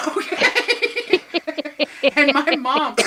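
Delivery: high, loud laughter breaking through the word; laughing out okay after the punchline. Real, not acted. Laughing at own story.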